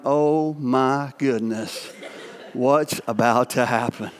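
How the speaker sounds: low, slow, half-laughing retold worry; mock dread. Dad watching his son drive off alone, low slow mock dread. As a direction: groan it out like a worried dad watching his car go. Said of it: "And I'm standing there going".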